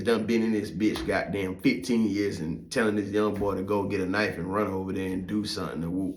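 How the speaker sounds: normal talking voice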